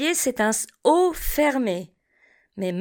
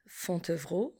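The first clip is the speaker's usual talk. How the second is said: Fontevraud repeated very softly and lower; 5 semitones lower, 13 dB quieter than usual. Shown as a demonstration. Teacher echoes Fontevraud quietly for the class. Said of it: "passons au son O".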